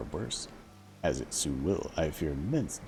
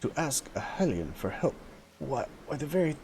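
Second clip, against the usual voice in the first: higher, put-on voice of a prickly Zora elder; indignant old advisor; 9 semitones higher than usual. Advisor protesting to his king, higher indignant voice. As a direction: bristle with offended pride at the idea. Voicing a second character, the advisor.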